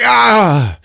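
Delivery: high, loud "ah" of pain; hammed-up yelp from a monster; mock pained howl. Monster shot in the behind, loud mock howl. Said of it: "Porno was like".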